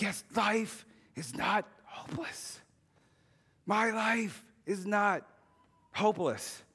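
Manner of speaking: slow, intense whispered shout; whisper yell. Retold or imitated. A boy alone in his room at night, fierce whisper yell. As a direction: yell it but in a whisper so nobody wakes. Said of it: "But I would whisper yell".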